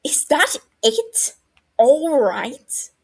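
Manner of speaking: high, loud, slow shriek of disbelief. A narrator's reading. Regulus shrieking at Barty over his reply. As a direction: let it fly up into a shriek.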